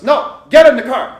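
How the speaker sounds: loud, slow, commanding yell; a loud, drawn-out command down the phone; shouted order. Retold or imitated. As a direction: bark it like a no-nonsense order. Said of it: "he goes".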